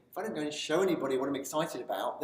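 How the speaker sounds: normal talking voice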